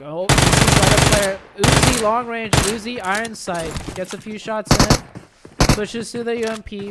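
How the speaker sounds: loud, rising excited call. Real, not acted. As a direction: call the fight with a burst of hype. Caster calls a sudden fight.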